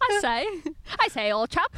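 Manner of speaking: hammed-up British old chap; mock British gentleman, British accent. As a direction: put on a playful British accent. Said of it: "that excellent British accent".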